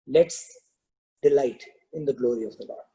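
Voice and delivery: mild imitation of an echoing voice, broken by pauses. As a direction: let the words hang as he falls. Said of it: "his voice is echoing".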